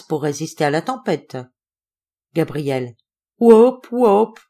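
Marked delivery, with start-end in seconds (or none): 3.4-4.4 cri de singe. Acted.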